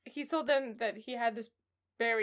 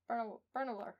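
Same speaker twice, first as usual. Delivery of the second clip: ordinary talk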